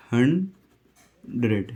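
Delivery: हंड्रेड drawn out very slowly and clearly; teacher showing the correct हंड्रेड slowly; slow model pronunciation. Shown as a demonstration. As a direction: stretch हंड्रेड out syllable by syllable. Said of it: "इसकी correct pronunciation ये होती है".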